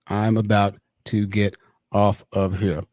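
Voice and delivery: low, slow, word by word; slow typing read-out. Real, not acted. Streamer typing a message to a guy, slow read-out. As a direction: say it haltingly as you type.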